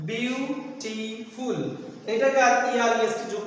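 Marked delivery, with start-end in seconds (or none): 0.1-1.6 "বিউটিফুল" very slowly in three separate syllables. Shown as a demonstration. Teacher showing the three syllables in "বিউটিফুল", each said slowly and apart. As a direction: break "বিউটিফুল" into বিউ, টি, ফুল with gaps. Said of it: "তিনবারে উচ্চারিত হচ্ছে".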